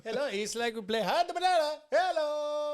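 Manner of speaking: high, goofy put-on greeting; playful squeaky hello; silly banana-game voice. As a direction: pitch it way up like a cartoon hello.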